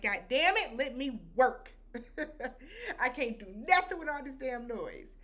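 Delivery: mock scream. Doctor yelling at the noisy divers, mild mock yell. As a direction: snap it out like a fed-up surgeon. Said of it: "the doctor screams out".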